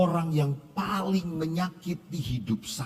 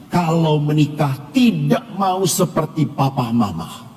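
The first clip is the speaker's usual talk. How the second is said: preaching; 9 dB louder than usual. Acted. Priest urging his congregation, preaching at full force. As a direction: drive the lesson home to the congregation.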